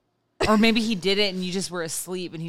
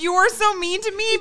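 high whiny mock; 10 semitones higher than usual.

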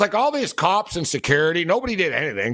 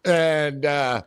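ordinary talk, nothing marked

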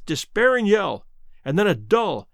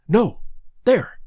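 higher, louder, abrupt pointing out; urgent correction; 3 semitones higher, 3 dB louder than usual. A narrator's reading. Doctor locating the cry in darkness, urgent correction. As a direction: correct yourself urgently, word by word.

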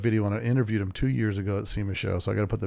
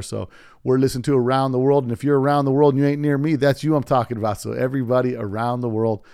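loud, hyped announcer read; podcast outro; 7 dB louder than usual. Acted. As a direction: pump up the listeners.

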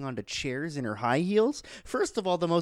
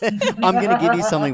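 laughing; 8 semitones higher, 11 dB louder than usual. Real, not acted. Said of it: "laughing restart of line 126".

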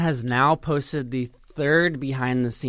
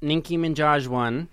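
usual speaking voice

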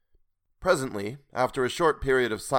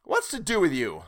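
higher, exasperated burst; 7 semitones higher, 4 dB louder than usual. A narrator's reading. Sailor confronting the strange boatman, bursting out. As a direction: burst out impatiently. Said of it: "I burst out".

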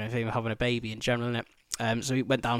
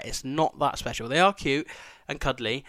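normal talking voice

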